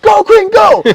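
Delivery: full-throated, very high cheer; yelling. Retold or imitated. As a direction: imitate a whole sideline shouting. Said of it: "the families are yelling".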